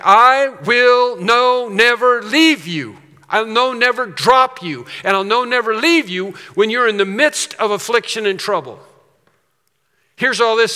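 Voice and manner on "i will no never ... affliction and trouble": emphatic God. Acted. Preacher voices God promising those in trouble, forcefully emphatic. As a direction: thunder the promise, then keep it strong and high. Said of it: "strong in that passage. It's like God saying".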